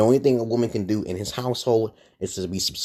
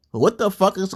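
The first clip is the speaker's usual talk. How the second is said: usual speaking voice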